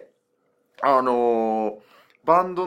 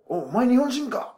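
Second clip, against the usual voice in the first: excited quote; 9 semitones higher, slower than usual. Retold or imitated. Colombian excited to meet Japanese.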